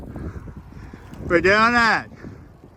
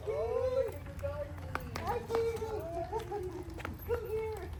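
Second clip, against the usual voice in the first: much higher, much softer sing-song pet talk; doggy talk; 13 semitones higher, 18 dB quieter than usual. Real, not acted. Owner greeting the excited dog at home in cooing baby talk.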